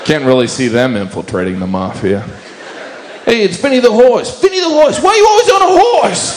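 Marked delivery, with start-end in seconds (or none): 3.2-6.4 high, loud hammed-up Italian-American mobster; bad Italian-American impression. Mocking a mafia guy greeting the horse cop, hammed-up Italian-American accent. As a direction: ham up a bad Italian-American wiseguy, building to a shout. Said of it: "worst Italian-American impression".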